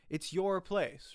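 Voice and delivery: ordinary delivery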